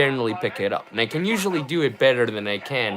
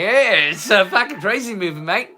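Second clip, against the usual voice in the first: higher, hammed-up Australian accent; 6 semitones higher than usual. Drunk vlogger repeating the line, higher hammed-up Australian accent. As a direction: push the Australian accent up a notch, higher and broader. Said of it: "My Australian accent isn't great".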